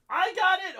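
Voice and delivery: quoting Connie. Retold or imitated. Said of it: "Connie's like".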